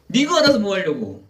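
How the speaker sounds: high-pitched mock insolence; imitated back talk, pitched well up; defiant retort. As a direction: act out a demon refusing to answer. Possessed person defies the pastor.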